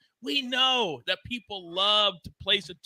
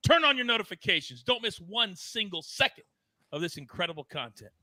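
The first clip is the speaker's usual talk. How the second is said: announcer sign-off; 5 dB louder than usual. Acted. Show sign-off hype.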